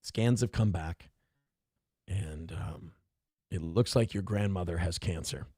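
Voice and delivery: low, soft, hesitant mock doctor; somber bad news. Doctor telling family grandmother has cancer, subdued and apologetic. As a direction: break it gently, with halting pauses.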